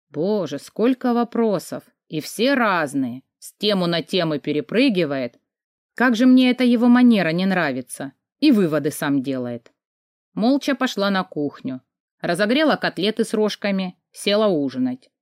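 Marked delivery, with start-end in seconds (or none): none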